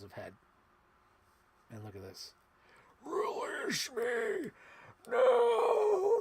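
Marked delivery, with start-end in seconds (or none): 3.0-6.2 very high, drawn-out alien cry. Retold or imitated. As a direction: wail it like the movie alien. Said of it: "when doing the alien voice".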